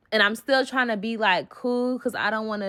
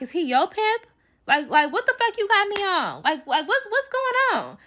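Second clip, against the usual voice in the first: higher, disbelieving questions; incredulous questioning; 11 semitones higher than usual. Retold or imitated. Confronting the friend about her pimp, incredulous and high. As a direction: rise in disbelief as you press her.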